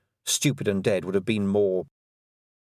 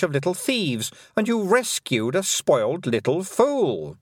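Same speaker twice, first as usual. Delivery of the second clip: higher, silky voice of a mocking fairy queen; lightly higher, condescending queen; 6 semitones higher, 3 dB louder than usual. A narrator's reading. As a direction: purr it out as the cruel Queen, sweetly taunting.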